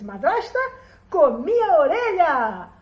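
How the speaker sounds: loud, drawn-out boast; slow, loud victory call; triumphant announcement. A narrator's reading. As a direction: belt it out proudly to the stepmother. Boy announces triumphantly to stepmother.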